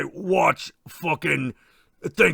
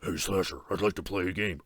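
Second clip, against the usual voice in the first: much lower, softer menacing Jigsaw voice; 10 semitones lower, 8 dB quieter than usual. Retold or imitated. Jigsaw inviting the slasher to play, low sinister voice.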